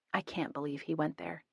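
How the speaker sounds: plain reading voice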